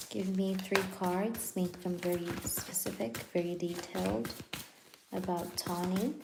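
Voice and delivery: quiet prayer. Real, not acted. Reader calling on spirits and Archangel Michael, softly praying. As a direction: hush down and pray to the cards. Asks spirits for tarot cards.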